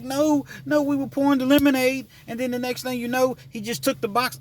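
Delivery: put-on high, rushed kid voice; imitating upset girls. Girl telling the driver how the box vanished, high and upset. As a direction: play a flustered young girl explaining. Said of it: "They was like".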